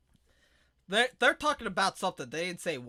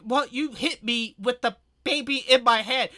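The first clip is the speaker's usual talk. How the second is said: shouting back; 5 semitones higher, 7 dB louder than usual. Retold or imitated. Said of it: "she would be like".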